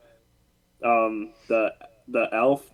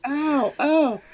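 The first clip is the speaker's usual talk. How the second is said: high yelps; much higher, hammed-up ow; Buddy yelping while being beaten; mock cries of pain; 14 semitones higher than usual.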